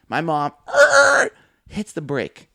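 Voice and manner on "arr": sudden high loud growling yell; battle yell. Retold or imitated. Girl braking hard to face the bully, loud roar. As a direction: let out a fighting roar. Mom yells hitting brake.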